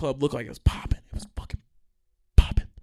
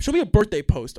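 mock bouncer demand; 8 semitones higher, 8 dB louder than usual.

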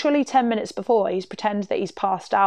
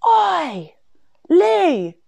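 high, loud call that falls away; shouting; 9 semitones higher, 5 dB louder than usual. Retold or imitated. Friend calls to him over the banister, shouting. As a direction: yell out to get his attention, imitated. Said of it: "she actually shouted".

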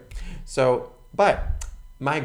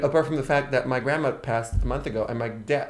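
ordinary delivery

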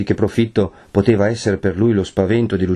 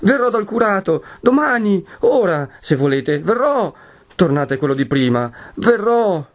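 high, urgent promise; frantic consent; 11 semitones higher than usual. A narrator's reading. Lucia agrees to visit the curate, frantic. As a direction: promise desperately to calm him. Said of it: "rispose precipitosamente Lucia".

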